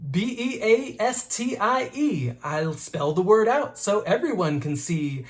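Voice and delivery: ordinary delivery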